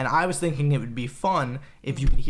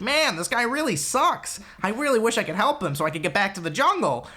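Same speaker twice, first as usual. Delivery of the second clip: Patton Oswalt; 7 semitones higher than usual. Acted. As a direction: put on a much higher character voice. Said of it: "that's kinda how he sounds".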